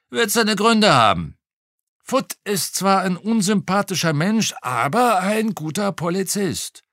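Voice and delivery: a hint of a gossiping retired policeman; old policeman voice. A narrator's reading. Retired cop chatting to the detective over lunch, lightly voiced.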